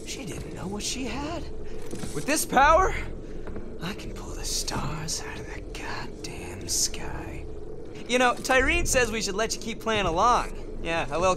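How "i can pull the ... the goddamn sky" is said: low, slow, whispered boast; hushed, drawn-out villain whisper; awed menace. Acted. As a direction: whisper it slowly, relishing the power. Villain marvels at his power.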